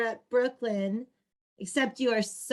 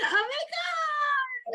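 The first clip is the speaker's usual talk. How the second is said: much higher, slow, drawn-out squeal; excited squeal; 13 semitones higher, slower than usual. Real, not acted. Thanking her friend for the wine, a slow high squeal. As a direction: let it rise into a delighted shriek. Excited over the rosé gift.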